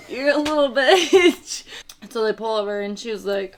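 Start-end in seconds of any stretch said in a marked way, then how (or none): 0.1-1.6 high, loud, drawn-out jeer; slow sing-out taunt; mock taunting. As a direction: tease him with a stretched-out insult. Acted mockery of man's driving.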